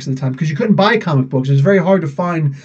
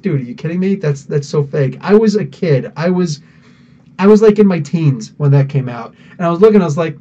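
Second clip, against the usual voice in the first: ordinary talk, nothing marked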